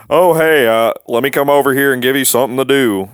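put-on high, loud, pushy old guy; mock old guy. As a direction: mimic a patronizing old man. Imitating an old customer.

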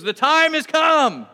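loud, high, drawn-out proclamation; bold declaration. Retold or imitated. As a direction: proclaim it to the whole crowd. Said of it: "he makes, like, this declaration".

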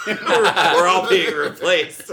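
high, loud, laughing panic; laughing mock panic. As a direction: wail it through laughter.

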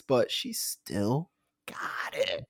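slow admiring whisper. Real, not acted. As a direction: breathe it out in a whisper. Sly compliment about Elizabeth.

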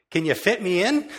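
begging a friend for a counselling slot; eager plea. Retold or imitated. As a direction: blurt it out hopefully. Asking a friend for a session.

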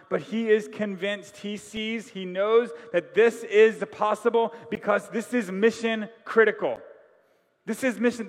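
fervent preaching. Real, not acted. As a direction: press the congregation hard. Preaching build.

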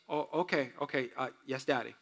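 soft, low and sheepish; a son meekly agreeing with his dad; meek child. Retold or imitated. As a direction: mumble like a scolded boy. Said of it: "he goes".

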